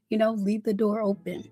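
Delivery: ordinary talk, nothing marked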